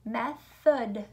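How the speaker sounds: "Method" broken in two, meth, then od; chunked into syllables. Shown as a demonstration. Teacher showing pupils the syllables, "Method" chunked in two. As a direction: say "Method" syllable by syllable, both beats distinct. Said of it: "how the word is chunked out".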